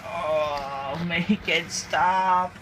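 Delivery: slow, drawn-out weary groan of a plea; exasperated plea. Retold or imitated. Fed up with the bad dub, a slow weary plea. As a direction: beg it to end, dragging the words. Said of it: "I thought".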